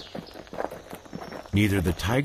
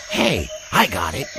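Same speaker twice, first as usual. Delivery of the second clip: higher, louder with a sudden idea; excited idea; 7 semitones higher, 3 dB louder than usual. Acted. Zeeby proposing their own group, excited. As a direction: burst out with the plan. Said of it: "sparked up the brilliant idea".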